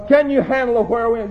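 ordinary talk

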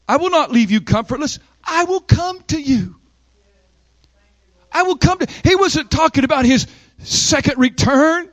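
high, impassioned preacher cadence; full-force preaching. Real, not acted. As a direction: preach it high, phrase by driving phrase.

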